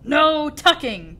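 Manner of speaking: loud, high, drawn-out refusal; slow pirate chorus shout; crew cry. A narrator's reading. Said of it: "the crew cried".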